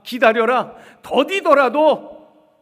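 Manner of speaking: very loud, drawn-out divine command; slow, booming promise from heaven; God's commanding voice. Acted. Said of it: "자세히 가르쳐 주셨어요".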